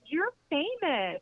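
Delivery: high and slow, drawn out in awe; starstruck. Retold or imitated. Boutique owner telling the narrator she's famous, high and awed. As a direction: stretch it out, amazed.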